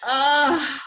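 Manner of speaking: high, loud groan of empathic hurt; pained groan. Retold or imitated. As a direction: groan as if stabbed in the heart. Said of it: "Like the knife in the heart".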